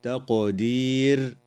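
"تقدير" with the qaf bounced as an echoing stop; qaf with qalqala. Shown as a demonstration. Teacher shows the qalqala of the qaf to students, slow bounce. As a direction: make the qaf in "تقدير" rebound with a slight echo. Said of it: "تقلقل القاف في كلمة".

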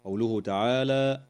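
ordinary delivery

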